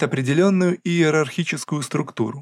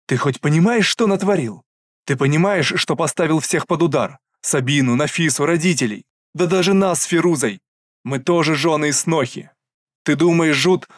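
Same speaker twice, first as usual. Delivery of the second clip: loud, furious tirade; angry scolding; 3 semitones higher, 4 dB louder than usual. Acted. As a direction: tear into him. Elder sister berates brother. (гневная отповедь)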